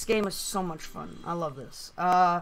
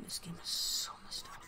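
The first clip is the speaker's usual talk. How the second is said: whisper; 9 dB quieter than usual. Real, not acted. Sudden whisper aside.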